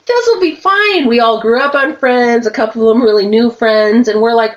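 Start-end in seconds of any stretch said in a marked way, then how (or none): 0.1-1.1 smug confidence. Retold or imitated. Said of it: "And I'm like".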